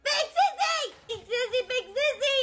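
put-on high, urgent child's call; frantic calling. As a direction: call for big sister in a panic.

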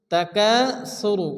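slow "তাকাসুরু" with long "কা"; measured tajweed "তাকাসুরু", middle stretched; elongated vowel. Shown as a demonstration. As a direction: hold the "কা" in "তাকাসুরু". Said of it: "এক আলিফ পরিমাণ টান দিয়ে".

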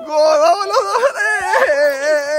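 high, drawn-out cheer; goal celebration. Real, not acted. Celebra un gol del niño. (grito agudo de gol)

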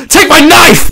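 high, loud battle cry; hammed-up knife-fight shout; mock attack yell. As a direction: lunge in with a shrill shout. Acted street fight attack.

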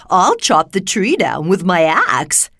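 loud character voice with a swooping tune; Tin Woodman. A narrator's reading. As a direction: offer help as the Tin Woodman. Tin Woodman offers help.

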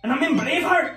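high, loud Scottish brogue; Scottish accent. Acted. Player gives partner a clue, Scottish accent. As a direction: exclaim it like a caricature Scot.

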